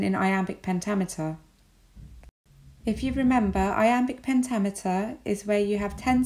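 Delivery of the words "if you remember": ordinary talk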